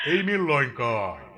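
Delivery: loud, slow final verdict; loud slow croak of doom; raven's cry. Acted. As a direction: pronounce it like a sentence of doom.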